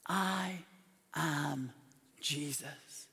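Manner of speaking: slow, low, soft pronouncement; solemn divine voice. Acted. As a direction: let each word land apart, hushed. Divine voice answering Saul.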